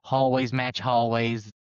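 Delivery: overdone fake New York accent; bad New York accent. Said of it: "it is bad New York accent".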